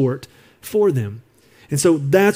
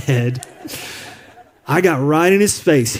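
ordinary delivery

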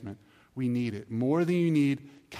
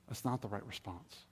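quiet repeat; 4 semitones lower, 12 dB quieter than usual. Real, not acted. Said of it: "repeated for emphasis".

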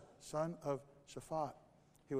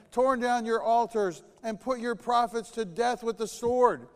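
loud, higher, indignant protest; angry complaint; 6 semitones higher, 12 dB louder than usual. Acted. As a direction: shout it at God like a fed-up prophet. Elijah's angry complaint to God.

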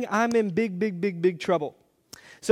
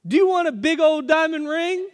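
put-on much higher, louder little girl calling Mom; child calling out; 9 semitones higher, 6 dB louder than usual. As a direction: call across the room like an excited kid. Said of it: "And then Emma goes".

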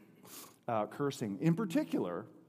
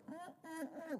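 high, teasing nyah-nyah sounds; mocking taunt; 13 semitones higher, 13 dB quieter, much faster than usual. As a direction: needle him with a sing-song eh. Kid taunting the son.

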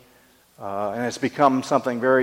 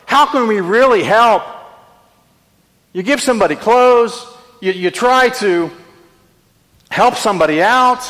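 full force preaching; 6 semitones higher, 5 dB louder than usual. Acted.

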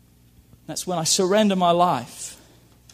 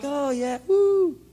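half-hearted cheer; 6 semitones higher, slower than usual. Pretend. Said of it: "not just half-hearted".

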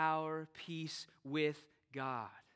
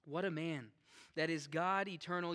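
ordinary talk

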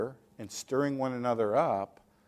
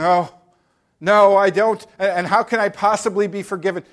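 louder, quick worried cry; anxious sinner; 5 semitones higher, 8 dB louder than usual. Acted. Guilty listener fearing no forgiveness, worried cry. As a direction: panic a little about forgiveness.

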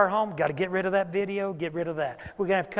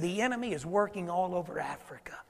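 whispering; 6 dB quieter than usual. Retold or imitated. Friend's warning retold.

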